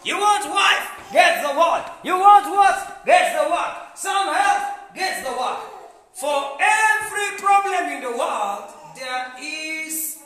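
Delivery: loud, high preaching in punchy call and refrain; forceful preaching. Real, not acted.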